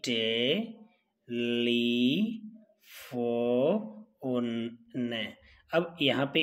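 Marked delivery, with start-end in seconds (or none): 0.0-4.8 "telephone" drawn out very slowly in separate syllables. Shown as a demonstration. Teacher writing the word in Hindi, "telephone" broken into slow syllables. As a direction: stretch each syllable of "telephone" long. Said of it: "Hindi में जब इसको लिखते हैं".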